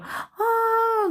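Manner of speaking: high wailing cry of despair; imitated crying scream. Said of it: "Biasanya kamu teriak".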